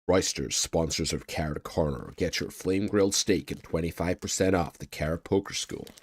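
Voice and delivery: playful sponsor plug voice; mock ad read. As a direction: read it like a cheesy radio ad.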